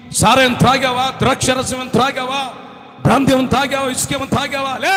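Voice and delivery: loud, high interrogation; accusing questions. Acted. Servant interrogating the woman, loud and high. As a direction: grill her about drinking.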